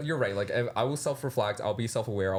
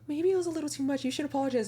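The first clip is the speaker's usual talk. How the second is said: much higher, prissy advising voice; mocking the team; faster than usual. Her team telling her to apologize, mock high-pitched. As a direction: squeak it like a fussy adviser. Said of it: "And they're like".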